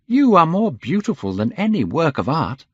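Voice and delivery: high, urgent pleading; impassioned cry. A narrator's reading. Painter to his despairing friend, urgent pleading. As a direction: plead earnestly, pitched well up. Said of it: "he cried".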